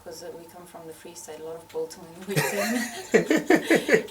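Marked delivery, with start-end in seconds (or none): 1.7-4.1 slow, louder, laughing through it; amused laugh at her home food. Real, not acted. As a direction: chuckle the words out slowly. Laughing about Free State food.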